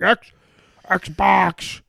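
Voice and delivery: loud, high angry shout; yelling. Retold or imitated. Frustrated gamer yelling at his Xbox, loud. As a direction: shout the name, fed up. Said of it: "I'm like".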